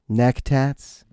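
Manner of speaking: usual speaking voice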